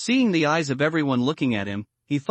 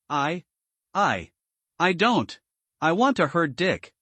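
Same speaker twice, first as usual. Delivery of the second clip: ordinary delivery, nothing marked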